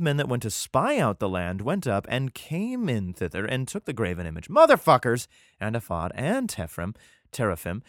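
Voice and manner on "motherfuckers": loud, high burst of mock outrage; outraged exclamation. Reader reacting to stolen graven image, outraged exclamation. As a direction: blurt it out loudly at their nerve. Outburst at the theft in story.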